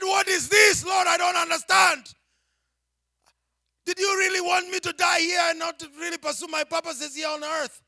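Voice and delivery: loud, high, pleading outcry; frustrated protest thrown up to heaven; shouting at God. Retold or imitated. Denied a visa again, crying out loudly to God. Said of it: "I started nagging God".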